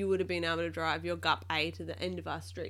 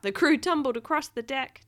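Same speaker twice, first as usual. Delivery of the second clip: louder, animated storybook reading; reading to a child; 7 semitones higher, 8 dB louder than usual. A narrator's reading. Parent reading Octonauts aloud to a child, lively storytelling. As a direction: act out each exclamation for a small listener. Rereads picture book.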